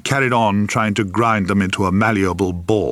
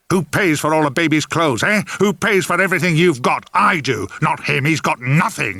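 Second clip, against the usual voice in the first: roar; 7 semitones higher than usual. Acted. Grandfather raging at the family about money, roaring. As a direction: bellow it at the whole room. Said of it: "he roared".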